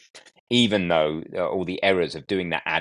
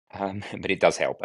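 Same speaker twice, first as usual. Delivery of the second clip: usual speaking voice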